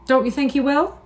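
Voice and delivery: high, anxious plea; begging to be reassured; pleading doubt. A narrator's reading.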